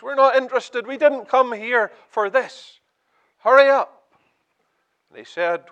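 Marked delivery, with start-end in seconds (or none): 0.0-4.1 rising, louder, higher, annoyed jeers; impatient crowd. Retold or imitated. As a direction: heckle the auctioneer to hurry up. Bored crowd at auction.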